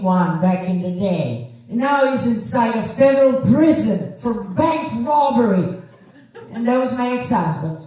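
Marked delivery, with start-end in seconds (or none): none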